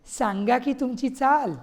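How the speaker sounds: thundering demand. Acted. Wife demands to hear his tune, scornfully. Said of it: "तेवढ्यात ती कडाडली".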